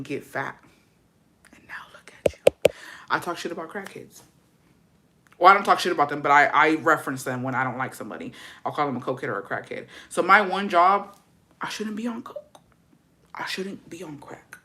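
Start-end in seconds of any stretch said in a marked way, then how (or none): 1.5-2.7 whispered gloating; a gloating whisper aimed at the bully; taunting whisper. Acted. Taunting her old bully. 11.6-14.6 whispered aside. Acted. Aside about her one job.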